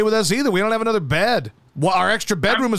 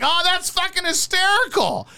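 mock amused friend; 9 semitones higher than usual. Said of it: "You're like".

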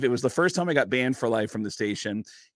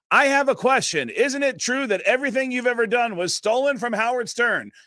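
loud accusing question; 9 semitones higher, 3 dB louder than usual. Retold or imitated. Confrontational question at event.

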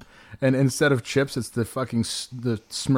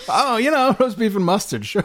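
high laughing words; 10 semitones higher, 4 dB louder than usual. Real, not acted. Laughing at the flavor names.

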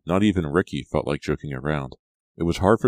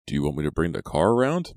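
usual speaking voice

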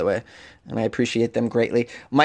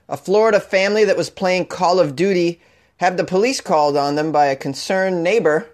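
put-on a light Boston accent on the news read; hint of Boston newscaster; 2 semitones higher than usual. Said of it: "I sounded so Boston there".

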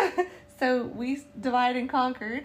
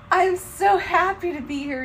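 higher and louder, bursting with joy; overjoyed; 8 semitones higher, 9 dB louder than usual. Retold or imitated. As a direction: gush with happiness. Retold joyful reply to pilgrim officer.

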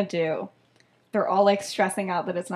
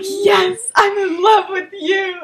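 high, loud, melodramatic cry; hammed-up swooning confession, laughing; mock-passionate declaration; 9 semitones higher, 6 dB louder, slower than usual. [name] answers the other beater, mock-passionate.

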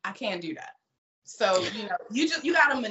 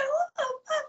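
much higher, stunned "What the fuck?"; shocked outburst. Retold or imitated. Cam girl reacting to rude viewer, shocked. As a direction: react as if slapped by rudeness.

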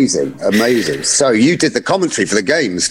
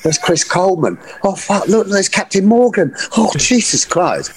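higher-pitched mock awe; retold starstruck awe; 7 semitones higher than usual. Fan spotting his football heroes, starstruck. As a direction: imitate a fan tongue-tied by famous players. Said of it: "I was actually genuinely starstruck".

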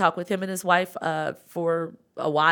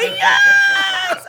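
high, loud, thrilled yes; excited shout; 10 dB louder than usual. Real, not acted. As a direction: burst out with joy. Excited reply at the party.

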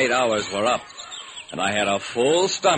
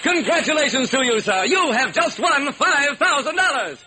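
high, loud showman host; radio announcer; 4 dB louder than usual. Acted. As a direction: hype the crowd. Host awards prize.

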